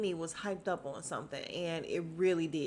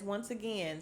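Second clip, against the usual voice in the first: usual speaking voice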